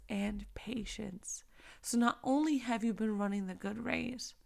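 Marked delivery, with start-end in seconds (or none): 0.1-1.4 soft whisper, drawn out slow. Retold or imitated. Sister answering the complainer, slow whisper. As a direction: breathe the words like a secret. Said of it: "Sister says".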